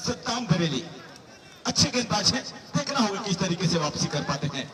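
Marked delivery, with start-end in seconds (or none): none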